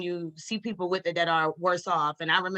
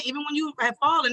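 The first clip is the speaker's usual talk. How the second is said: usual speaking voice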